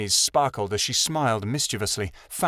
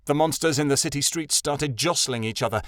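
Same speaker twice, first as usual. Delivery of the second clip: ordinary delivery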